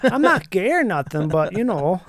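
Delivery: put-on slow Wisconsin welder accent, easygoing; a lightly voiced out-of-work welder from Wisconsin.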